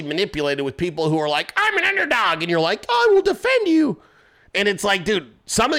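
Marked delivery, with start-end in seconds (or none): 1.6-2.4 high-pitched mocking plea; exaggerated poor-me imitation; mock underdog. Mocks self-declared underdogs in a quote. 2.9-4.0 high, put-on heroic cry; exaggerated gullible-supporter voice; mock eager defender. Mocks their gullible would-be defenders.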